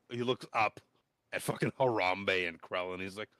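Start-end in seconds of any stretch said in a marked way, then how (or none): none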